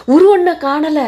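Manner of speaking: high, loud panicked repeat; alarmed cry. Acted.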